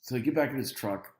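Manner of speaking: ordinary talk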